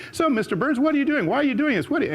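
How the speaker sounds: high, eager reporter's questions; eager interviewer. Retold or imitated. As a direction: fire questions like a keen TV interviewer. Film crew interviewing him mid-run.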